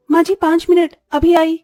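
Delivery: high, loud call back. Acted. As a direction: answer a summons from another room, hurried to reassure. Answers mother-in-law's call from afar. (ऊँची आवाज़ में जवाबी पुकार)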